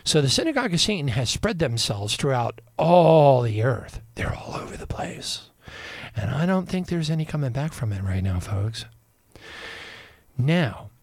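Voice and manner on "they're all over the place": hushed aside; whisper. Real, not acted. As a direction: drop to a whisper. Dark warning aside.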